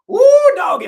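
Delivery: big hooting cheer, way up high; excited whoop. Acted. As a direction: whoop it out at full volume. Whooping before the big topic.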